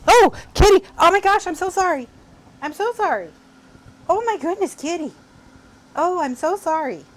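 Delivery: high, loud burst of sorry to the cat; real alarmed apology; startled apology. Real, not acted. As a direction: gasp and gush apologies at the cat. Accidentally rolled onto the cat.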